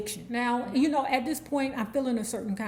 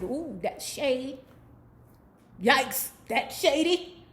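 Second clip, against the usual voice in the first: scandalized reaction; 3 semitones higher, slower than usual. Retold or imitated. Retelling own gossipy reaction.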